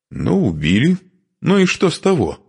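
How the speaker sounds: high, testy comeback; quarrelsome. A narrator's reading. Widow dismissing talk of her killed husband, quarrelsome. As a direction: brush off the reproach crossly. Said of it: "сварливо отозвалась женщина".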